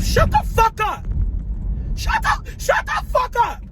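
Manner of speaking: high-pitched exasperated shriek; angry outburst. Real, not acted. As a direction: snap it out high, drawing out the words. Angry retort to imagined critics.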